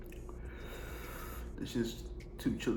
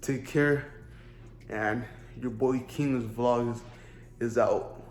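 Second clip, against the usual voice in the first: vlog outro; 5 dB louder than usual. Acted.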